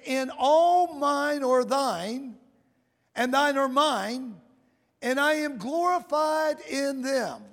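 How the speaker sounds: slow, measured reading of the prayer; solemn scripture reading. Acted. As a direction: draw out each phrase like a holy recitation. Reads Jesus' prayer from Scripture.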